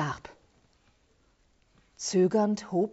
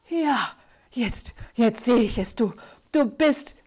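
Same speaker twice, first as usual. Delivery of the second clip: death rattle; 3 semitones higher than usual. A narrator's reading. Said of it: "röchelte die Fratze".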